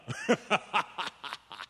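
amused laugh trailing off; hearty laugh. Acted. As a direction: laugh him off as he leaves. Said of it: "laughing heartily".

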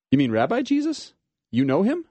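floored that he knows him; astonished. Acted. Judah astonished at news.

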